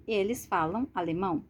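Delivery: ordinary talk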